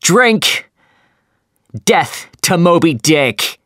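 very high, loud roaring toast; toast shout. A narrator's reading. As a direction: raise a wild toast to the crew. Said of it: "he shouted".

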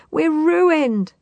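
high, loud wail of despair; sobbed. A narrator's reading. Farmer's wife finds the sheep gone, sobbing high. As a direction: wail it like a storybook sob. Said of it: "sobbed Missus Hill".

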